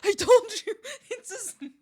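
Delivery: high voice broken by giggles; can't finish for laughing. Real, not acted. Laughing, breaks off.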